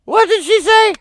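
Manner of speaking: mock shock at what she said; mock disbelief. Real, not acted. Streamer reacting to crying woman, high mock disbelief.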